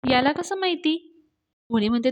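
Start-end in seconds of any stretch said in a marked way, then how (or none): 0.1-1.0 high, puzzled questioning; surprised. A narrator's reading. Avni wonders how Raja knows, high-pitched surprise. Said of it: "अवनीला आश्चर्य वाटतं".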